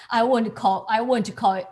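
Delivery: normal talking voice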